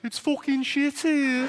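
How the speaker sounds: high, slow, drawn-out Brummie moan; Birmingham accent. Retold or imitated. Comic mocking Birmingham to the audience, slow Brummie. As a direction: Birmingham whine stretched out. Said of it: "other phrase that seems to come up a lot in Birmingham".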